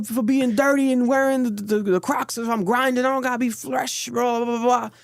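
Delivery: high, hyped streamer impression; high mock streamer voice. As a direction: squeak it out like a hyped kid. Quoting streamer, high far voice.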